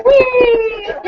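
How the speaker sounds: high, loud, playful whee; mock child glee. Mom voicing her son enjoying the ambulance siren, gleeful. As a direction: squeal like a kid on a ride. Son's ambulance ride retold.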